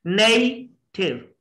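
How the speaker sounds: Native slowly as NAY, pause, thiv; split into syllables. Shown as a demonstration. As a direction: break native into two slow beats. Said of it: "एक तो हो गया नई और दूसरा थिव". (Native दो हिस्सों में, ठहर कर)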